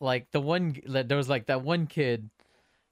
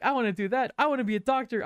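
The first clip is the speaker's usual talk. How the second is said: high-pitched, eager imitation of friends rallying; retold excited outburst; 11 semitones higher than usual. Despairing friends catch the kid's hope, calling out excitedly. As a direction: chime in high, acting out newfound hope.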